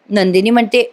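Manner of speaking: usual speaking voice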